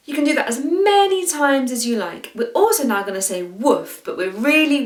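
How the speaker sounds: ordinary talk, nothing marked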